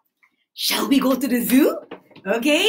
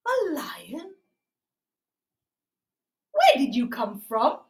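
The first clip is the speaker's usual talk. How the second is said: ordinary talk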